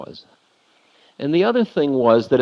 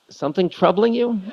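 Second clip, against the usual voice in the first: gentle teasing question; 9 semitones higher than usual. Retold or imitated.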